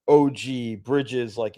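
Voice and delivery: ordinary delivery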